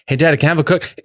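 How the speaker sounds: put-on high-pitched little kid voice; openly voiced, high kid request; son asking out loud. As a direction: imitate a child blurting a request, cut short. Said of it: "he'll say it out loud".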